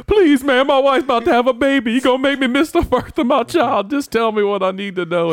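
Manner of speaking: loud, high hammed-up wail; imitated crying man pouring it out; tearful begging. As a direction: plead desperately through mock sobs. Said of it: "and he's crying".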